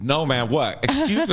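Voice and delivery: high squeaky excuse me; mock offended. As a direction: act shocked and offended. S2 imitates S0 taken aback.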